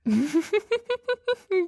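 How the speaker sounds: a high, giddy vampire giggle; delighted laugh. Acted. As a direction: laugh up high, charmed and amused. Vampire delighted by his blush.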